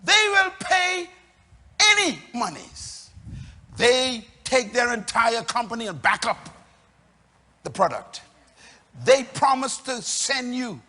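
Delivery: loud, ringing preacher's build; full-voiced sermon climax; preaching at full force. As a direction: drive it home to the congregation.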